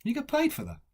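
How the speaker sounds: high-pitched, disbelieving question; incredulous thought. Acted. Pub listener reacting to talk of office work, incredulous. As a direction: voice leaps up in mock disbelief. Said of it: "you just left thinking to yourself".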